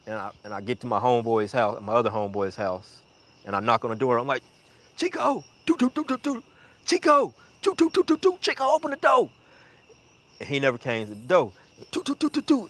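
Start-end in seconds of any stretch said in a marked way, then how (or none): 5.0-9.3 high-pitched urgent call with mimicked knocking; acting out banging on a friend's door. 11.9-12.7 high, rapid door-knock noise; rat-a-tat vocal knocking; imitated knocking. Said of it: "I'm still knocking".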